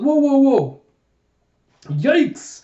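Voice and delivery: loud, high wobbling yelps; acted alarm. Jayha swaying on the roof edge, acted alarm. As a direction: cry out as if losing your balance.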